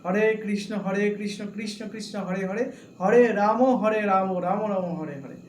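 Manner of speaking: mantra chanted firmly, each হরে and রাম said clearly and fully; correct mantra pronunciation. Shown as a demonstration. Teacher demonstrating the correct chant to devotees, clear and firm. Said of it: "সঠিক উচ্চারণটা এই".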